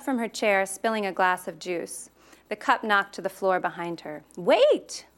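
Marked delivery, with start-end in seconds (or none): 4.4-5.1 calling out. A narrator's reading. Nina chasing Raisa up stairs.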